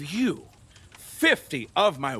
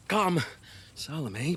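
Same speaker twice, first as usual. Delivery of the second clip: ordinary talk, nothing marked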